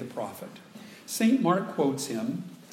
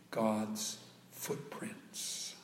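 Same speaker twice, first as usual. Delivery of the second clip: hushed whisper; 4 semitones lower, 9 dB quieter, much slower than usual. Acted. Hushed reverent climax of sentence.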